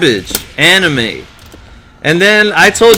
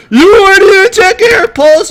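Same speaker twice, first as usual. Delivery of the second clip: very high squawking pig character; mock anime outrage, pitched way up; anime temper tantrum; 12 semitones higher than usual. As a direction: throw a hammed-up cartoon tantrum. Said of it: "getting into this temper tantrum".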